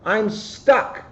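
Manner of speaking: high, strained little voice; stuck rabbit. A narrator's reading. Rabbit stuck in the ditch pleads, high and strained. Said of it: "she cried".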